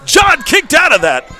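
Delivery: excited high ringside call; wrestling commentator. Real, not acted. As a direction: call the pin like live TV. Live wrestling play-by-play.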